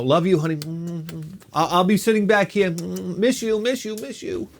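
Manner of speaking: syrupy farewell, fading off; mock sweet goodbye. To his wife as he heads to the back seat, mock tender. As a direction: coo it, then let it trail away.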